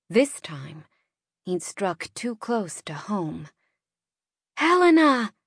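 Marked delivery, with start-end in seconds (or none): 4.6-5.3 shouted name, high and loud; shouting. A narrator's reading. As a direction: call her name out loud. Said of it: "I shouted".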